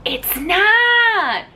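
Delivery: high, loud flat denial; emphatic rejection. Real, not acted. Reviewer rejecting Edward's excuse, high and loud. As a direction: shut the excuse down hard.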